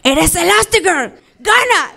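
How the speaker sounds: dad's proud cheer, climbing high on gana; mock cheering yell across the parking lot; retold shout. Father cheering his daughter at university, mock shout. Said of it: "me gritaba".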